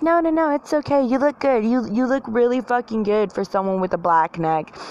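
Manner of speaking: mock enabling-friend coo; false reassurance. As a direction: reassure a friend far too sweetly.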